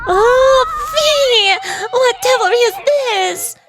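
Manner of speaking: high, slow theatrical knight; hammy stage actor. Acted. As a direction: overblown storybook hero cry. Don Quixote wakes, theatrical outburst.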